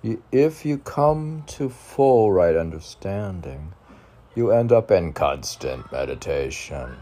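poem reading. Acted. Podcaster reciting his poem to listeners, mildly declaimed. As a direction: reads out his own poem with mild emphasis. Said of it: "All right, poem".

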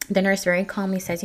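ordinary delivery

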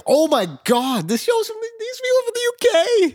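very high, squealed disbelief; astonished. Retold or imitated. Viewer realizing the cast is British, hammed-up astonishment. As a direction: blurt it out in high-pitched shock. Acts out own shocked realisation.

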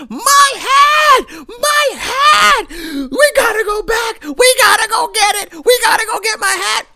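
put-on shrieking high panicked kid wailing for his hat; kid freaking out. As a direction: wail it like a seven-year-old losing his hat.

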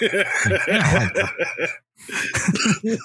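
high, breathless laugh through words. Real, not acted. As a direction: laugh while saying it. Laughing at the impression.

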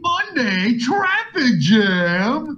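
high, slow radio announcer voice. Pretend. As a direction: announce it like a cheesy morning show. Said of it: "This ain't morning radio".

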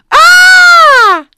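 high, piercing shriek of horror; horrified scream. Pretend. Opening the door to a horror, a high shriek. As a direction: scream like you've found a body. Finds sister shot at door.

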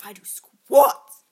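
shouted slow boast; loud boast. Pretend. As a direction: belt out the brag. Shouted boast in comedy game.

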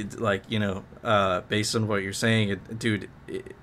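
ordinary delivery

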